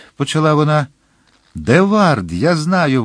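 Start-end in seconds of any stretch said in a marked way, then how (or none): 1.5-3.0 higher-pitched blurted answer; eager exclamation. A narrator's reading. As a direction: jump in with a lightly acted cry. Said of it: "вигукнув Дартаньян". (піднесений вигук здогаду)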